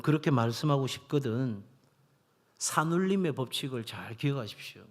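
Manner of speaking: normal talking voice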